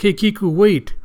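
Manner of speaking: calling after. Acted. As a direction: try to stop someone running off. Chopper calling after running Kiku.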